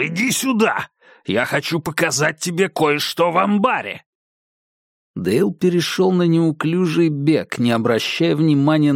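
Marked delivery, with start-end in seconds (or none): 0.0-0.9 high, furious shout; shouted command. Acted. Повтор зова шерифа. 1.2-4.0 high, angry yell across the yard; yelling after him. Acted. Шериф кричит вслед.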